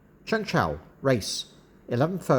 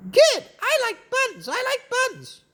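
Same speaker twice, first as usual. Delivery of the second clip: high, squawky parrot voice; excited parrot; 10 semitones higher than usual. Acted. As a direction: chirp it like an eager parrot. Parrot chimes in about buns.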